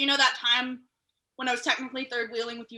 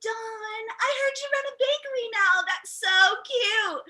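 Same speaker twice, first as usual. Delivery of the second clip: high, sugary fake-sweet voice; mocking classmate voice; 9 semitones higher, slower than usual. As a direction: put on a catty schoolmate.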